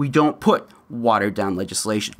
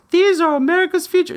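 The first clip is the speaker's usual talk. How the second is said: high, loud sing-song mockery; mocking adults praising the children; mock earnest adult; 4 dB louder, faster than usual. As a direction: ham up a sanctimonious adult. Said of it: "usually it's the adults are saying".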